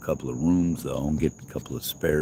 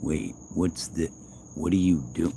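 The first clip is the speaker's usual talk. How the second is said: ordinary talk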